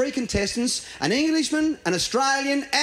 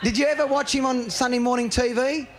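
normal talking voice

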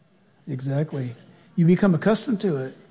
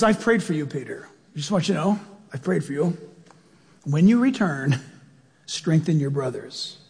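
soft, lower and gentle; tender Jesus; 5 semitones lower, 3 dB quieter than usual. Pretend. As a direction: reassure Peter quietly. Jesus speaking tenderly to Peter.

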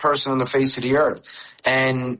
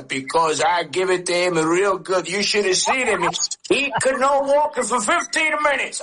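mock boast climbing higher on each line; imitating a proud father; 7 semitones higher than usual. The father boasting to the uncle about the hit, in a higher mock voice. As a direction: brag about it like the father did, pitch rising. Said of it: "And my father would go".

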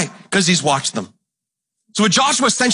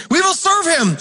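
the people again; 10 semitones higher than usual. Retold or imitated. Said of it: "They're saying all the right things".

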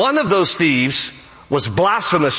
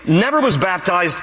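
normal talking voice

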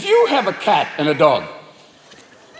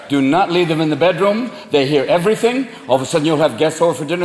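normal talking voice

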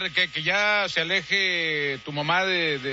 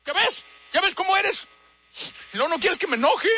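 much higher, hammed-up rage; mock anger; 9 semitones higher than usual. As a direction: work yourself up into a fake fury. Prank caller rising anger.

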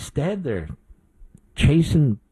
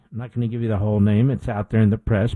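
usual speaking voice

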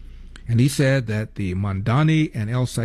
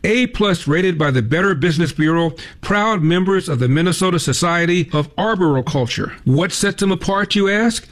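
upbeat, louder, higher pitched ad pitch; radio ad read; 5 semitones higher, 4 dB louder than usual. Acted. Host reading a sponsor ad to listeners, upbeat. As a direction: sell the local tree service like a spokesman.

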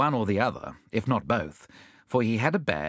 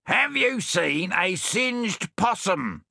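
shouted; 8 semitones higher, much slower than usual. Acted. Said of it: "shouted Bill".

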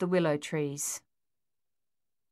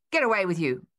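scaring off; 3 dB louder, faster than usual. Acted. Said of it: "trying to scare it off".

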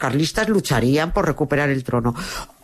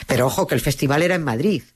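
ordinary talk, nothing marked

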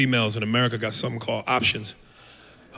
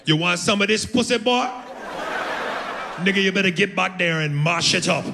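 higher Jamaican patois, demanding; Jamaican woman; 7 semitones higher than usual. Retold or imitated. Comic playing the Jamaican lover, demanding patois voice. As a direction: push it at him in Jamaican patois. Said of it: "Jamaican broad".

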